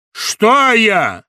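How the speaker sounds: громко и высоко, с вызовом; огрызнись с угрозой; огрызаясь. Acted. Гундосый огрызается. (злобный вызов)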